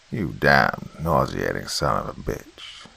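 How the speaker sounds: a hint of a monster's screamed insult; angry scream. A narrator's reading. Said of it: "screamed the Slender Man".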